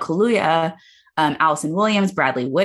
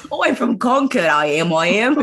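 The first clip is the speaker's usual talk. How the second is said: higher, hammed-up fake American accent with British slipping through; Emma Watson's bad American accent; 6 semitones higher than usual. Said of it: "Your accent sucks".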